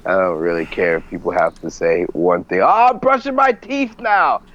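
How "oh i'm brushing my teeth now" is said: sarcastic high-pitched tweet voice; mocking high voice. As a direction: parody a boring tweet.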